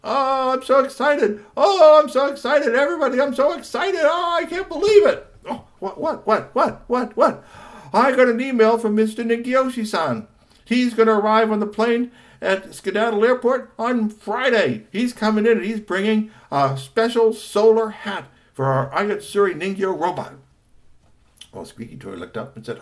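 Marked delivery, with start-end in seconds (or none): 0.0-5.3 very high, loud, stretched-out cries; wildly excited father. A narrator's reading. Father comes home to family, wildly excited. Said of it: "he was excited". 5.5-7.4 high, loud rapid-fire asking; excited questioning. Acted. Family asks excited father for news, excited. 7.9-20.3 high, loud breaking-news delivery; excited father. A narrator's reading. Father tells family about email, excited. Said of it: "he was excited".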